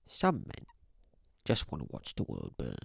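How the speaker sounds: a light go at a Cockney butler; lightly voiced Michael Caine, low on the end; Michael Caine impression. Streamer doing a light Michael Caine impression for chat. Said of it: "pretty good impression of Michael Caine".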